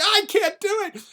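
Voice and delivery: high-pitched distressed cry; acted anguish. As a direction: cry out as you give up.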